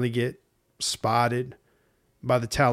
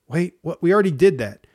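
higher, much louder protest; indignant shout; 5 semitones higher, 8 dB louder than usual. Pretend. The weary inner voice protesting being asked to go again. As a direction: blurt it out in outraged disbelief.

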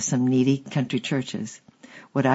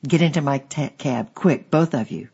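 ordinary delivery

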